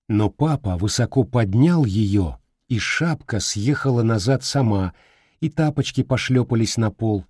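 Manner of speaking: ordinary delivery, nothing marked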